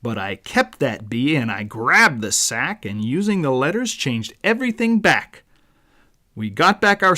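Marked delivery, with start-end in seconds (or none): none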